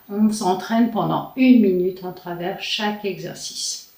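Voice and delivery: ordinary talk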